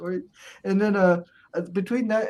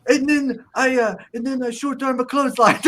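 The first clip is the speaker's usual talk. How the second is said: Iron Sheik impression; 7 semitones higher, 6 dB louder than usual. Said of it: "He said".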